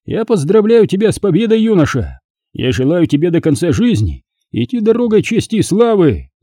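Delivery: high-pitched ceremonial congratulation; magister's grand toast. Acted. Magister toasting the young victor, solemn and exalted. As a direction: salute the victor in ringing declamation.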